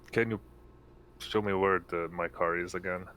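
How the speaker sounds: ordinary delivery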